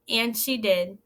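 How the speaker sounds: normal talking voice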